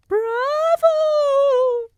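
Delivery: very high, loud cheering witch; cartoonish high cheer; audience yelling. A narrator's reading. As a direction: cry bravo as a crowd of witches. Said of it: "yelled the audience".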